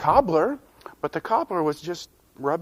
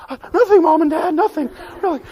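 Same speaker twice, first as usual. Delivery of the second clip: much higher, squeaky innocent voice; squeaky nervous denial; 12 semitones higher, 5 dB louder, faster than usual. Acted. Boy hiding his news from parents, squeaky denial. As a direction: play it off guiltily high.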